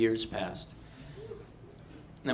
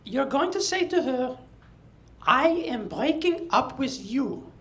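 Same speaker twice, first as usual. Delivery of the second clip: high, slow French teacher dictation; French accent; 12 semitones higher, 3 dB louder, slower than usual. Retold or imitated. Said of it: "she spoke like this".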